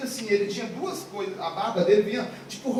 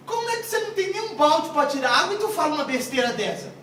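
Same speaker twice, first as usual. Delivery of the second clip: acted high-pitched incredulous retort; scoffing disbelief; 6 semitones higher, 4 dB louder than usual. Woman acted scoffing at Jesus. (réplica incrédula em tom agudo)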